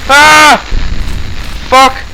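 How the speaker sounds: sudden loud scared yelp; startled scream. Real, not acted. Gamer jumps at Slender, screaming loud. Jump scare in horror game.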